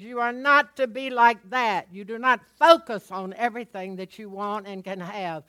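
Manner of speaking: loud, driving preacher voice; full-force preaching. Real, not acted. Pastor exhorting the congregation on faith, loud preaching.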